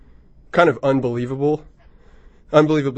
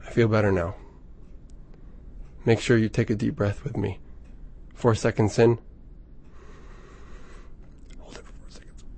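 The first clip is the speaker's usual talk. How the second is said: guided breathing; 4 semitones lower, 11 dB quieter than usual. Acted. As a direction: breathe along with them, hushed. Leads a breathing exercise.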